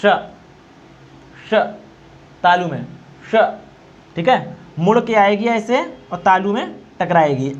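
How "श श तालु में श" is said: श with the tongue curled to the palate; palatal sh demo. Shown as a demonstration. Teacher demonstrating palatal श to students. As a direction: hiss out श against the palate. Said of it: "तो इसका उच्चारण ऐसे निकलेगा".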